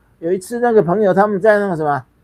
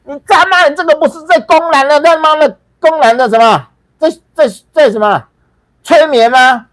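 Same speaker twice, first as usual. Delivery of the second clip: yelling much higher and louder in a rage; furious shout; 11 semitones higher, 7 dB louder than usual. Retold or imitated. Young man yelling at the lama in the hall, furious shout. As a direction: stand up and shout him down from the back row. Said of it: "我一生气".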